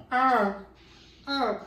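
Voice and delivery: imitating her loud high moans, yeah; mock moaning. Deaf partner moaning during sex, mock loud moans. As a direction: moan it out, loud and high, hammed-up. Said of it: "she's just in there going".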